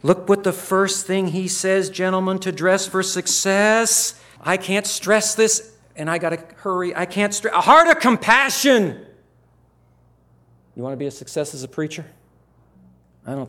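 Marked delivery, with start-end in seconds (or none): 0.0-9.0 urgent exhortation. Acted. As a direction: press it home to future pastors. Exhorting the students.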